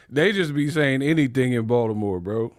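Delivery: ordinary talk, nothing marked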